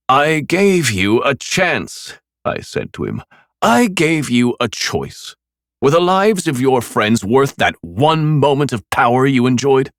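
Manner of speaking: slow taunting drawl; mocking. A narrator's reading. Killer circling Nick, slow mockery. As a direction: savour each word as a taunt. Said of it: "I continued mocking him".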